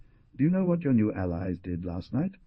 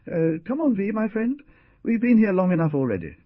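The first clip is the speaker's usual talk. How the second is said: much higher, insistent call; urging; 12 semitones higher, 6 dB louder than usual. A narrator's reading. Hunter turning to his friend to leave, high urgent call. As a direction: call the friend away, high and getting louder.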